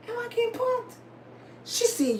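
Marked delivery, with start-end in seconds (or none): 0.1-0.9 high, soft squeak of suspicion; comic timid disbelief; bewildered. Retold or imitated. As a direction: ask it small and squeaky. Acts out her bewildered suspicion.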